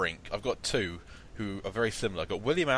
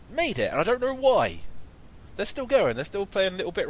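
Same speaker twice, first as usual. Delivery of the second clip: excited; 8 semitones higher, 3 dB louder than usual. Real, not acted. Insisting on his pick.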